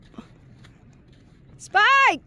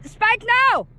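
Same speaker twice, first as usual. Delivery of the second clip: normal talking voice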